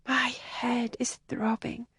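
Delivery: slow, hushed and aching; pained whisper. Acted. Helen with a migraine whispers to Kathleen. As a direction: murmur it through a splitting headache.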